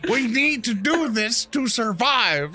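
pleading pep talk. Acted. Owner pleading with his dog to keep biting, slow high pep talk. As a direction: coax the dog back slowly.

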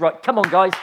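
loud, rousing call to the youngsters; rally them over the storm; rallying the group. Retold or imitated. Rallying youth group, acted.

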